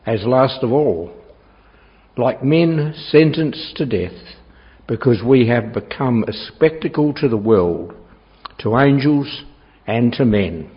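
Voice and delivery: normal talking voice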